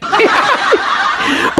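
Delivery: high breathy chuckle; short laugh. Real, not acted. Host chuckling at his own analogy. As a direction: chuckle at the comparison.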